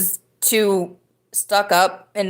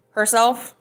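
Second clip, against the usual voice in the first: normal talking voice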